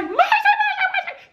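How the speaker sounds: high pleading squeals, fading out; begging squeal. Retold or imitated. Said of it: "I'm like".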